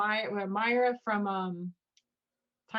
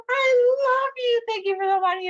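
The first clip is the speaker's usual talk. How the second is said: mock gushing; 13 semitones higher, 7 dB louder than usual. Mocking the show's girl to a friend, high gushing. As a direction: overdo the sweet gratitude.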